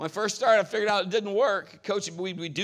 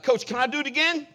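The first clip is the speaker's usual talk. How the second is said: eager athlete; 6 semitones higher, 6 dB louder than usual. Retold or imitated. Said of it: "I'd be like".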